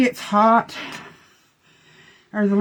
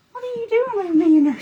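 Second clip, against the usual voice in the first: much higher, fast whiny dog voice; voicing the dog; 13 semitones higher, faster than usual. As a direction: put words in the dog's mouth, whining. Voices dog's complaint again.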